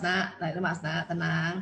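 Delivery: put-on soft, low soothing to an upset child; calm parent soothing a kid. Shown as a demonstration. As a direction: gently settle the child down. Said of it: "kalau lihat anak-anak lagi kesel".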